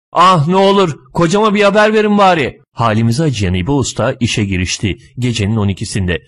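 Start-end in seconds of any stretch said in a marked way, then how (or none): 0.1-2.6 high, loud acted wail; a woman crying as she begs; tearful pleading. A narrator's reading. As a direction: wail the plea, pitch held high. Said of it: "Kadın ağlamaya başladı".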